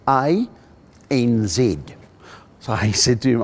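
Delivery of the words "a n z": letters spelt out in a mock Australian voice; Aussie correcting the pronunciation; imitating an Australian; Australian accent. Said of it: "an Australian guy said to me".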